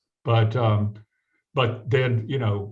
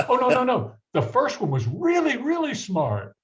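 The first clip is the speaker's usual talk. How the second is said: much higher, playful teasing correction; teasing professor; 14 semitones higher, 3 dB louder than usual. Retold or imitated. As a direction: hike the voice up to land the joke. Professor's punchline retold.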